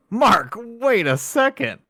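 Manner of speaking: high, slow, stunned realisation; drawn-out shocked disbelief; a sudden double take, high and slow; surprised double take. Retold or imitated. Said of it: "I was like".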